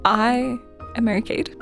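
ordinary delivery

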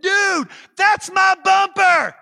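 drawn-out, high yell across a road; urgent shout. Retold or imitated. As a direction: holler it slowly at full volume.

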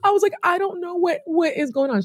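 high, cracking, tearful voice; near tears. Retold or imitated. Telling the doctor she doesn't know what's wrong, high and tearful. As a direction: let the voice crack as if about to cry.

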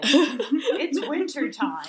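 slow, laughing through the words. Real, not acted. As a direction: chuckle it out slowly. Laughing at the cold show.

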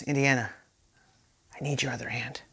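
hushed, breathy plea to his son; whisper. Retold or imitated. Father whispering to his son to give up, hushed. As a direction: lean in and whisper it.

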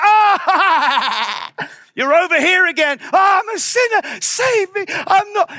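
loud, high wail of mock despair; acted frantic plea, high and loud; panicked sinner. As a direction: cry out like a desperate sinner, hammed-up. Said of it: "suddenly you're like".

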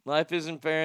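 ordinary talk

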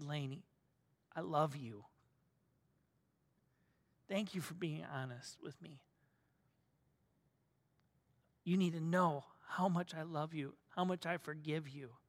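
hushed, soft voice of a forgiving dad; tender fatherly forgiveness. Retold or imitated. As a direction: reassure your child softly, with long pauses. Father comforting his crying daughter.

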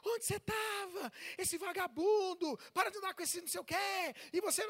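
put-on mother screaming at her teenage son; scolding mother. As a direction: yell at the boy like a fed-up mother.

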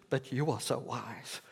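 understated mock praise on "so wise"; sarcastic. Paul needling the people in his letter, dryly sarcastic. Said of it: "can be quite sarcastic with the people".

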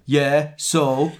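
ordinary talk

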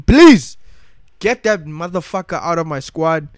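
screamed in frustration; angry shout. Real, not acted. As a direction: blow up on the last word. Furious rant about a player.

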